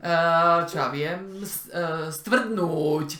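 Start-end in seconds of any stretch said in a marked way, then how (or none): 2.1-3.1 stvrdnúť with the ú drawn far out; exaggerated long vowel in stvrdnúť; overlong syllable. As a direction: stretch the ú in stvrdnúť. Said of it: "suena chistoso, porque se prolonga".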